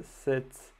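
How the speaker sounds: clumsy put-on English accent on "set checked"; French coder saying "set checked" in bad English. Shown as a demonstration. Coder naming his React state, in a clumsy English accent. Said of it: "Désolé pour mon accent anglais abominable".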